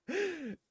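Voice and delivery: high squeak of laughter. Real, not acted. Still cracking up at the boss, high laugh. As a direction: another helpless laugh.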